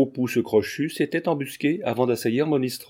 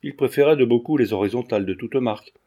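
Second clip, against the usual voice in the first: ordinary delivery, nothing marked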